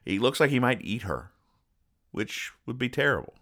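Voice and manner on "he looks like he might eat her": usual speaking voice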